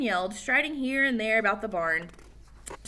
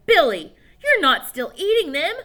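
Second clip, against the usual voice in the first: shocked mother; 8 semitones higher, 9 dB louder than usual. Acted. Mother discovers worm eating.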